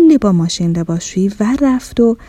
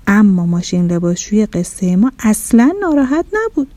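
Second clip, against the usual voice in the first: usual speaking voice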